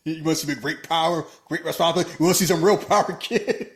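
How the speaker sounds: loud, high sneering taunt; mock villain taunt. Villain taunting the kid about real power, loud sneer. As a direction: bark it out like a cocky villain. Villain handing Spider-Man a gun.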